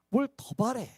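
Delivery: ordinary talk, nothing marked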